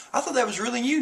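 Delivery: high-pitched naive little voice; Chopper. Retold or imitated. Chopper realising his mistake about Robin, high naive voice. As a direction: lift it well above your own, imitating. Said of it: "He'll be like".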